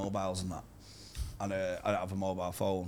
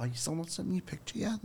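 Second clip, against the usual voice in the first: put-on high, quick, softer girl voice; lightly voiced girl asking, pitched up; imitating girl; 7 semitones higher, 5 dB quieter, faster than usual. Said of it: "She went".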